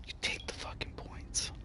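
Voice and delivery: hissed whisper, no voice at all. Real, not acted. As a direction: whisper it through clenched frustration. Muttering after a loss.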